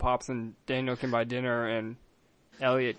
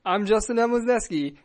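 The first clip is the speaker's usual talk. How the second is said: ordinary talk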